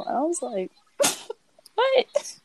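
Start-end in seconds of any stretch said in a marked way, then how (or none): 1.7-2.4 high, loud incredulous what; retold stunned reaction; shocked what. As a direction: squeak it in disbelief. Reacting to his creepy remark.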